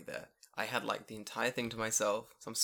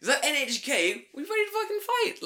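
loud, high mock-polite greeting to intruders; mock polite then fight; 10 semitones higher, 10 dB louder than usual. Podcast hosts greeting police who kick the door, loud and high. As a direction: greet the raiders loudly, ready to brawl.

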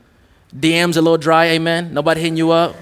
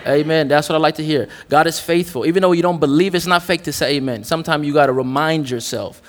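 usual speaking voice

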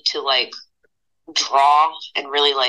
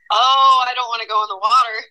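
laughing fear; 6 semitones higher, faster than usual. Retold or imitated. Retold terror after pike strike.

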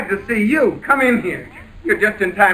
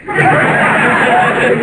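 a short, high, incredulous laugh; nervous disbelieving laugh; 5 semitones higher, 3 dB louder than usual. Acted. George laughs at being told he has a wife, nervous laugh. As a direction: laugh it off like it's a prank.

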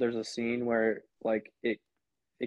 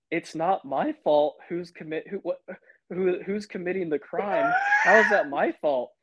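higher-pitched mock protest; indignant self-defence; 7 semitones higher than usual. Peacemaker defends himself to the janitor, indignant. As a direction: stumble into an exasperated defence, ending on a push.

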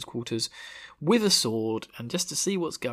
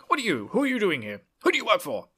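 high-pitched, hammed-up interrogation; mock yelling; 8 semitones higher than usual. Leanne yelling at the intruder, mock outrage. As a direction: fire questions in a put-on high yell. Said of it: "yelling at him".

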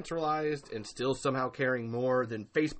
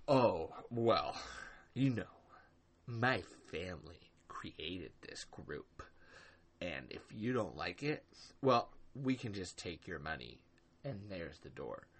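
lower, much softer, slow smug voice; mocking Glenn; 5 semitones lower, 6 dB quieter than usual. Host mimicking Glenn dismissing a longtime mod, smug low mockery. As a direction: sneer it out like a smug gatekeeper. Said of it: "to literally say".

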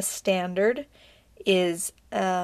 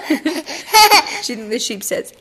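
slow, louder, through a laugh; 6 semitones higher, 8 dB louder, slower than usual. Real, not acted. Parent playing with a giggling toddler.

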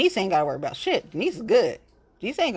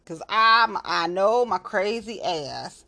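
ordinary talk, nothing marked

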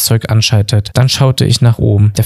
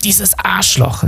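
high, drawn-out, softer cursing; indignant curse; 9 semitones higher, 5 dB quieter, much slower than usual. A narrator's reading. Bob curses on spotting the poster, indignant.

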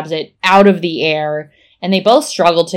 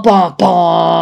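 loud sung-out "bum bum" reveal cue; dramatic sting; 7 dB louder than usual. Pretend. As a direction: mimic a cliffhanger music sting. Voices dramatic reveal music cue.

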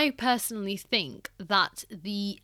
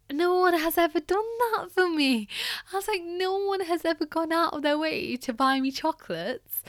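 high, emotional retelling of being moved; choked-up gratitude, pitched well up; close to tears; 8 semitones higher than usual. Retold or imitated. Volunteer moved by a customer's gift of chocolates, near tears. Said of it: "I literally was gonna cry".

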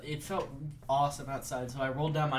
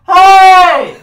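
very loud, high-pitched holler; retold shout; 25 dB louder than usual. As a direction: imitate a guy yelling from a car window. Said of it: "he shouts to me".

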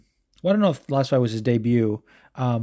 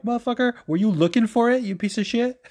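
much higher, half-joking furious retort; mock outraged yell; 10 semitones higher than usual. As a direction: fire back at the troll in high mock rage. Retold comeback yelled at troll.

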